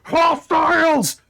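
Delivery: high panicked cry of alarm from the guard; alarmed warning. Acted. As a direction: shout a warning to the others. NPC raising the alarm.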